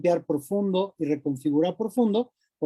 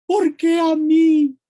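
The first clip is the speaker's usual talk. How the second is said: high, loud drawn-out victim wail; victim's lament; 10 semitones higher, 9 dB louder, much slower than usual. Acted. Victim protesting their situation, an exaggerated loud wail. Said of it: "desde el rol de víctima".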